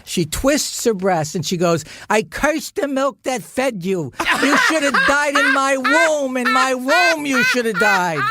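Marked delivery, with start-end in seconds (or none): 2.1-8.2 melodramatic mother curse. Retold or imitated. Mother cursing her son at dinner, high theatrical wail. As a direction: curse him like a tragic opera mother.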